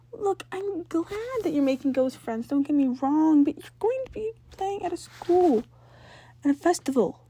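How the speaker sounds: put-on older sister warning her brother about ghost hunters; worried older sister. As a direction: lecture him like a frantic big sister. Jazz voiced lecturing her brother.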